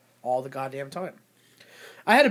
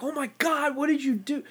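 high-pitched mock dismay; put-on horrified exclamation, pitched up; feigned shock; 8 semitones higher than usual. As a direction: act aghast at the other driver. Acts out feigned shock at driver.

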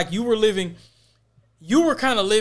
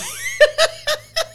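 high laugh; 3 dB louder than usual. Real, not acted. Recalling the heavy-set girl.

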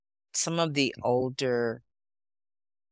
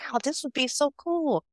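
high-pitched retold wow; acted naive excitement; 13 semitones higher than usual. Student delighted at being offered the station, acted high excitement. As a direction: gush it as an imitated younger self.

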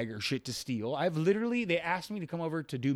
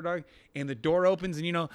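normal talking voice

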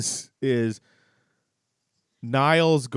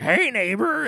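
cheery neighbor; 11 semitones higher than usual. Retold or imitated. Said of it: "being like".

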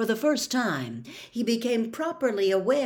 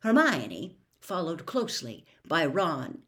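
usual speaking voice

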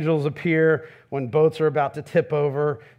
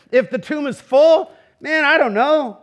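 higher and louder, a flippant shrug; joking shrug; 8 semitones higher, 6 dB louder than usual. Shown as a demonstration. Preacher jokes about the bleak alternative, loud and flippant. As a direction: toss it off with a jokey shrug. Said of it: "contrasting empty and full tomb".

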